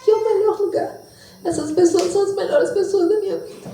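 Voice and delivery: high, tearful-happy exclamation with a laugh; a touch of laughter through happy tears; overjoyed relief. Retold or imitated. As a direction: relive the joy of coming home. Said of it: "cheguei na minha casa e falei".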